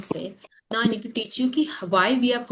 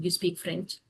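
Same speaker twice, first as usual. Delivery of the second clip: "you speak French" softer, with no final rise; statement tone; 3 semitones lower, 7 dB quieter than usual. Shown as a demonstration. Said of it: "if you are not, uh, uh, using a raising tone".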